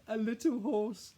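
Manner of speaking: put-on sing-song the joke back like a delighted small boy; giggling little boy. A narrator's reading. Little brother Billy repeating the joke, high slow delight.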